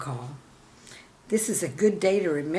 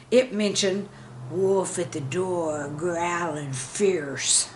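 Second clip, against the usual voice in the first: ordinary talk, nothing marked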